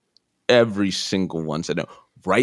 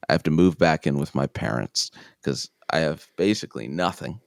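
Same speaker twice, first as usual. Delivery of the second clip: ordinary talk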